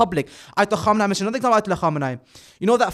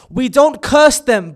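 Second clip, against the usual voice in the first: slow and louder, "curse" hit hard; firm emphatic denial; 4 semitones higher, 7 dB louder, slower than usual. Retold or imitated. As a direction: insist on it, spacing the words. Quoted marja argues firmly.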